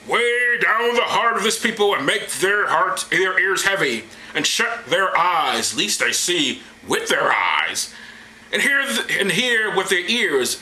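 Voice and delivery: slow, grand divine command; hammy scripture oration; the Lord's voice. Acted. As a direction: proclaim it with drawn-out mock majesty. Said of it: "And the Lord said".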